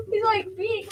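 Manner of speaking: laughing through tears. Real, not acted. Child laughing and crying.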